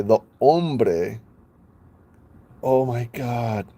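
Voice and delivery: ordinary delivery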